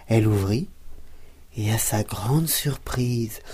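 ordinary delivery

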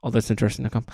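very fast, clipped-off web address; rattled off fast. Shown as a demonstration. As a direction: blurt the address at double speed. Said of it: "One more time".

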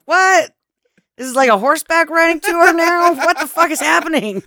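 high, loud incredulous rant; mock bewildered shouting. Podcaster reacting to misheard vacationers, loud bewildered outburst. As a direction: yell in comic confusion.

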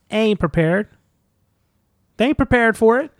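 high-pitched, playful taunt; hammed-up, high comic boast; mock hype threat. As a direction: crow it like a joke warning.

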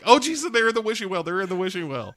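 high, rushed blurting; panicked confession. Acted. Acting panicked Crystal giving in.

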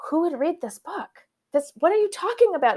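high-pitched mock disbelief; incredulous. Retelling her dismay at the agent, high incredulous voice. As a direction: squeak out the shock of being asked.

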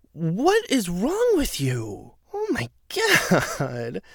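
slow, high exasperated disbelief; appalled at him; drawn-out what is wrong. Real, not acted. Reacting to Jose's boast.

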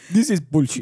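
the real Scotsman version; better Scotch accent. Pretend. Host shows her how a Scottish accent goes. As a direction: redo it in a put-on Scottish accent. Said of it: "That's not a Scotch accent".

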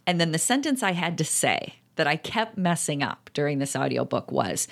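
usual speaking voice

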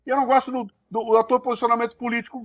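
mock a political critic; a hint of a whiny critic. Voicing another complainer.

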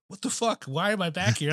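high, puzzled mock exclamation; retold what-the-hell disbelief, pitched high; baffled. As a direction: act out your own bewilderment with a laugh in mind. Retold confusion backstage.

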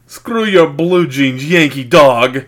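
slow, loud, hammy Russian villain; Russian accent. Pretend. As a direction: sneer it like a Cold War heavy. Mock Russian villain line.

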